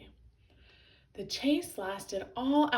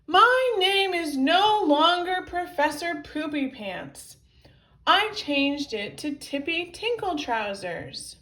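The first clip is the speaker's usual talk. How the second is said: loud, high proclamation; angry raised-voice announcement; indignant shouting; 7 semitones higher, 8 dB louder than usual. A narrator's reading. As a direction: declare it at full volume, offended. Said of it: "shouted Tippy".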